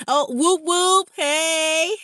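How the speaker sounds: loud, high party whoop; loud whooping cheer for a viewer from Vegas. Real, not acted. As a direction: hype up the Vegas crowd.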